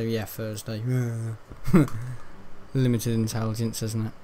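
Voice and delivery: normal talking voice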